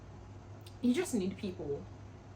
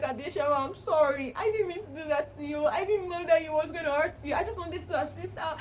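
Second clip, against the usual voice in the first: higher, pleading, a mock tearful sorry; imitating crying apology; 8 semitones higher, 3 dB louder than usual. Friend apologising in the shower, mock tearful pleading. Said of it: "And she was crying".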